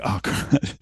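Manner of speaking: whispered oh no; mock dismay. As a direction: breathe it out like you've been caught. Caught mirroring by the CEO.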